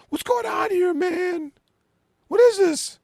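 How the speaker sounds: high, loud outburst of disbelief; retold angry yell. Storyteller acting out the guy confronting David, high yell. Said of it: "and the guy goes".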